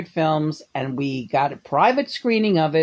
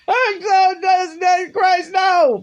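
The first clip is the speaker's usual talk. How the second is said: laughing; 12 semitones higher, much slower than usual. Real, not acted. Laughing exclamation.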